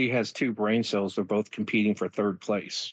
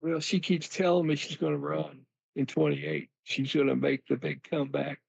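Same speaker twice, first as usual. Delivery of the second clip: a lightly voiced folksy Bill, a bit higher; Bill Clinton impression; 4 semitones higher than usual. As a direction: play Bill grinning about his wife's comeback. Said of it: "Okay, Bill".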